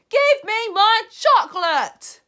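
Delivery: high, loud and slow demand; insistent greedy boy yelling. Acted. Bruno demanding chocolate, slow loud yell. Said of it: "Bruno demanding".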